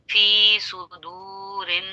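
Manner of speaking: फी सुदू with फी stretched long and सु short; drawn-out recitation. Shown as a demonstration. Teacher slowly reciting फी सुदू so students hear the lengths. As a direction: stretch फी, clip सु, hold दू. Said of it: "दू पे खींचा, एक अलिफ".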